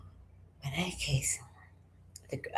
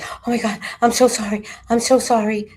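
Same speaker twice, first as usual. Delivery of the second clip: imitating a frightened, higher voice; rapid-fire pleading, pitched up and louder; panicked apology; 6 semitones higher, 4 dB louder than usual. Hairdresser apologising to the client she angered, panicky.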